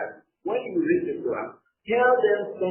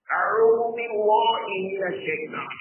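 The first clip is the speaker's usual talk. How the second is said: ordinary talk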